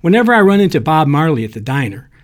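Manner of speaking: plain reading voice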